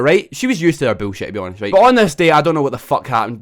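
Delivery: ordinary talk, nothing marked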